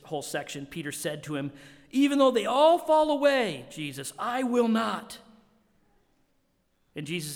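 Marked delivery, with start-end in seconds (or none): 1.9-5.2 high, loud, proud declaration; bold boast. Retold or imitated. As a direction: swear loyalty at the top of your voice. Peter boasting to Jesus.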